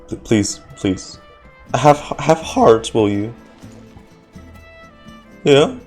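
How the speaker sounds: normal talking voice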